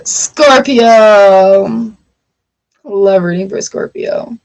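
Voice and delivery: normal talking voice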